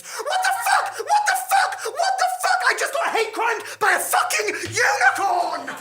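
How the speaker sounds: very high, loud laughing shriek. Acted. Thomas after the unicorn gores him, hysterical laughing shriek. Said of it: "Thomas shrieked".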